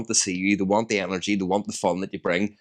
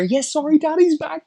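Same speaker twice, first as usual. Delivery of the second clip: much higher, doting sing-song daddy voice; daddy voice to Ruby; 5 dB louder than usual. Retold or imitated. A dad on the phone showing off to his daughter, high and doting. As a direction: coo at the child like a proud dad.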